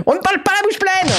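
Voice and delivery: high, shrill-pitched strict carer scolding; scolding nurse. Pretend. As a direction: tell him off like a nanny. Scolding Jay at table.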